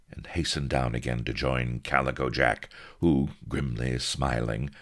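ordinary delivery

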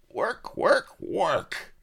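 high, loud snarl of complaint. A narrator's reading. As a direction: spit out each "work" like a griping workman. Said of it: "he snarled".

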